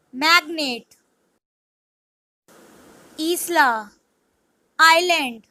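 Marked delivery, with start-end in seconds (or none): none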